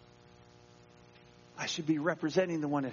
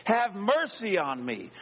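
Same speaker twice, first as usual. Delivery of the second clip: raised-pitch plea, drawn out at the end; mildly acted cry for mercy; calling out; 7 semitones higher, slower than usual. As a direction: plead aloud, stretching the last words. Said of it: "Are you the one calling out".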